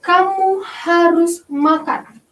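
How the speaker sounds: slow, firm command; teacher demonstrating an imperative sentence; commanding example. Shown as a demonstration. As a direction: say it as a firm order. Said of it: "ada contohnya".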